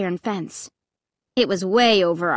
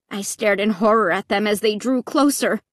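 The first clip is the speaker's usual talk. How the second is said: ordinary delivery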